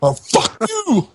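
high, slow mock yell; retold yell at bug. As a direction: roar it at the centipede. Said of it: "fucking killing this thing".

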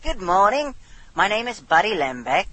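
lilting mock British phone greeting; put-on British accent. Prank caller introducing himself to a stranger, put-on British accent. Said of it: "you gotta do that British accent".

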